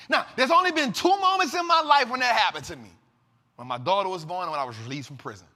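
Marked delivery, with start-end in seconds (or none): 0.1-2.9 emphatic. Real, not acted. Emphatic declaration to viewers.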